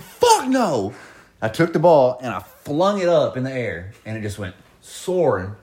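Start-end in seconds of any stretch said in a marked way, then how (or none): 0.0-0.9 high loud fuck no; defiant refusal. Retold or imitated. Player refusing the coach's order to run, defiant. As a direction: refuse flat out. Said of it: "I said".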